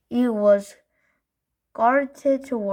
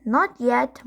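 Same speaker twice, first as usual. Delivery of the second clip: ordinary delivery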